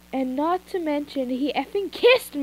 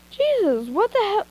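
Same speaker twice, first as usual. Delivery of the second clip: alarmed; 8 semitones higher, 3 dB louder than usual. Acted. Screams heard outside.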